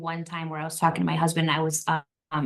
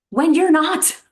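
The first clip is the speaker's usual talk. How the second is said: loud, high exclamation breaking into laughter; laughing protest; 9 semitones higher, 10 dB louder than usual. Real, not acted. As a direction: call it out, laughing, pitched way up. Laughing at a common false claim.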